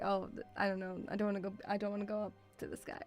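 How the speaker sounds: ordinary talk, nothing marked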